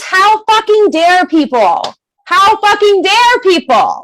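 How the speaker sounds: high-pitched indignant outrage; indignant, leaning on each word; righteous anger. Acted. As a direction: exclaim it, affronted on everyone's behalf. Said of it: "you need to be angry".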